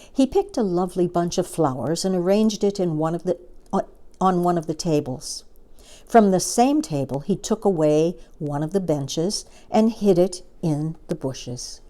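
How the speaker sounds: ordinary delivery, nothing marked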